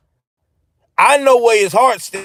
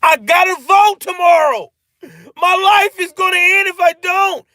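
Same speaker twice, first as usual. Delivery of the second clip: panicked voter; 10 semitones higher than usual. Retold or imitated. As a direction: yell it like the world ends if you miss the vote. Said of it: "get to the point where they're like".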